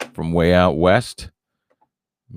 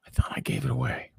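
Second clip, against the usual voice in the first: whisper; 8 dB quieter than usual. Real, not acted. Aside to himself.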